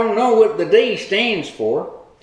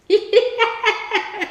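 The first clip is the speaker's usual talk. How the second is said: high cackle; 11 semitones higher than usual. Real, not acted. Laughs at his own joke.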